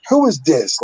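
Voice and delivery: sassy grandmother answering the phone. Retold or imitated. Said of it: "in a sassy voice".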